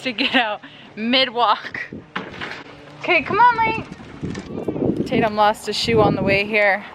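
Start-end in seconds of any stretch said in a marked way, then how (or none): 3.0-5.1 high coaxing call. Real, not acted. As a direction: coax him along, high and light. Calling toddler on walk.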